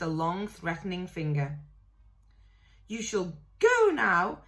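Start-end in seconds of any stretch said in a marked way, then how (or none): 2.9-4.4 slow, louder, fierce command. A narrator's reading. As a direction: order him out, each word firm. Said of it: "he said fiercely".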